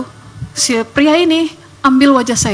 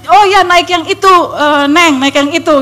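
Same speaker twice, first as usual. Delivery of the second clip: raised, louder voice of a driver giving directions; helpful driver; 4 semitones higher, 5 dB louder than usual. Retold or imitated. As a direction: call out directions to a young passenger, lightly acted. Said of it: "gitu ya panggilannya".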